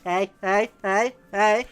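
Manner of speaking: loud warning hey hey; loud scolding. Real, not acted. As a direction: call out loudly to stop her. Scolding the cat.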